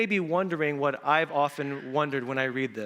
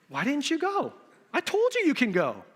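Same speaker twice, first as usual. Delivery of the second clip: higher voiced mock wife; exasperated wife; 10 semitones higher than usual. Wife scolding husband for staying home, mock exasperated. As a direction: needle him, fake puzzled.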